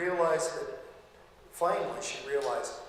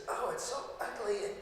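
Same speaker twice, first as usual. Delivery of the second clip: high, whiny sleep-talk voice of the wife; Steve's wife grossed out in her sleep, high and whiny; 11 semitones higher than usual. Retold or imitated. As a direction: squeal it like a disgusted dreaming wife. Wife sleep-talking, quoted.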